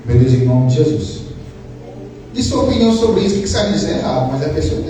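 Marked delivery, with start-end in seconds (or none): 0.0-1.2 sample prayer. Shown as a demonstration. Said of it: "Clamar a Deus".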